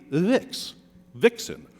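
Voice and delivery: "vix" said slowly and alone, then "vixen" with the weight on VIX; stressed syllable demo. Shown as a demonstration. Poet explaining the rhyme to the jury, stress on VIX. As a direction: isolate "vix" before the full word "vixen". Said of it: "stress on the first of the two syllables".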